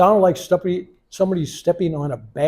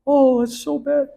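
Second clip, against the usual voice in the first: high-pitched imitation of a crying wife; retold tearful dismay; 11 semitones higher than usual. Guru's wife seeing the injured foot, mock tearful. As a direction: mimic her distressed wail, lightly. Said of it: "she started crying".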